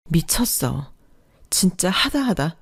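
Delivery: breathless whispered disbelief; shocked whisper. Acted. Wife finding husband cheating, whispering in disbelief. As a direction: hiss it under your breath in shock.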